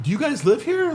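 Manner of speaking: high mock-astonished question; mock surprise. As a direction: play dumb, loudly astonished. Mock surprise at illegal rental.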